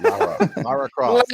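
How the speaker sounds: high, laughing British "Lara Croft" with a long open "Lara"; hammed-up British accent, said through a laugh; mock British accent. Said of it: "with a little bit of a British accent".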